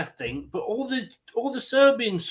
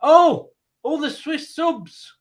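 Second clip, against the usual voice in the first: excited; 7 semitones higher, 5 dB louder than usual. Real, not acted. Sudden commotion on the pitch.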